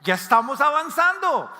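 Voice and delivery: slow, drawn "Oh my gosh", then high and loud; overjoyed at your progress; excited. Retold or imitated. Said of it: "Usted dice".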